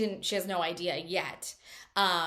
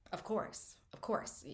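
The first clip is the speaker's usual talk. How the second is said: mock whisper; 9 dB quieter than usual. Guys eagerly agreeing, quoted.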